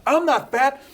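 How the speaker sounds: high, loud, slowed protest; flustered victim denying the insult; mock defensive. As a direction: whine it defensively, overreacting. Acted defensive reaction.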